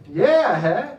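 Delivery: loud bluffing yeah huh; fake understanding. Comedian bluffing through Dutch conversations, loud laughing. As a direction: pretend you got it. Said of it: "but I say".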